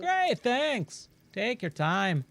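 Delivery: hammed-up mongrel accent for a friendly traveling trader; Giro in muddled accent. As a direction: blend Australian, Irish and Scottish accents, playfully. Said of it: "I have no idea what accent that is".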